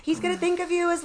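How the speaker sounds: very high, loud, fast mock tirade; screeched imitation of a furious scolding; stepmother lacing into her. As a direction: tear into them in a high, racing voice. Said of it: "laced into me".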